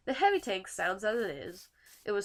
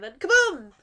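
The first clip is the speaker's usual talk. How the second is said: excited kaboom; 9 semitones higher, 12 dB louder than usual. Acted. Explosion sound effect in explanation.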